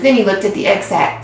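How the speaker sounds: ordinary delivery, nothing marked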